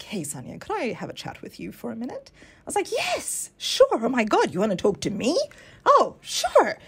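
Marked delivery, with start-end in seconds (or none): 0.0-2.3 soft, hushed invitation from the boss; hushed summons. Retold or imitated. Senior colleague quietly asks Sonia for a word, hushed. 2.9-6.8 high, bright-eyed eager yes; chirpy and eager. Retold or imitated. New staffer eagerly answers the senior boss, chirpy and high. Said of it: "very chirpy".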